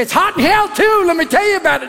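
high-pitched mock evangelist; overeager zealot. Pushy believer answering small talk about heat, high mock zeal.

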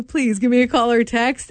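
ordinary talk, nothing marked

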